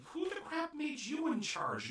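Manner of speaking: high, slow, mock-outraged challenge; indignant friends. Friends challenging Hidenori for taking charge. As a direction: scoff at the self-appointed leader. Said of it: "and they're all like".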